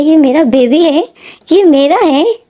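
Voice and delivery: put-on clutching the baby in pure happiness; overjoyed. A narrator's reading. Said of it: "की खुशी का तो ठिकाना ही नहीं था".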